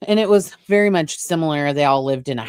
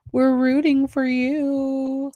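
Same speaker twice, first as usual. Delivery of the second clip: mock Tyra meme; 10 semitones higher, slower than usual. Quoting famous meme line.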